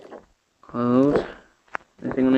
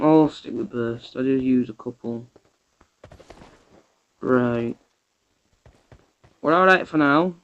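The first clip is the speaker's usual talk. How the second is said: ordinary talk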